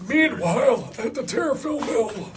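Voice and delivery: put-on high old-timer voice; old man voice. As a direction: drop in a quick old man voice. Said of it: "the old man voice".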